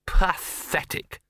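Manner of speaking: slow, drawn-out scornful misery; miserable disgust. A narrator's reading. Gaspode watching the obedient dog, miserable disgust. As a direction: stretch the word out in wretched disgust. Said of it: "said Gaspode miserably".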